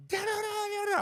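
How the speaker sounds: squeaky high nonsense babble; mocking falsetto gibberish. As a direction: parody him rattling on in a silly high voice. Mocking friend's babbling reply.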